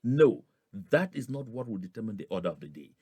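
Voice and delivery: usual speaking voice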